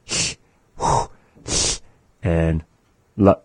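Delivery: H, HU and S as bare voiceless breaths, LA cut short; isolated speech sounds. Shown as a demonstration. Teacher reviewing four sounds not found in English, isolated sounds. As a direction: sound out each one separately: h, hu, s, la. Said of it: "Those are the sounds".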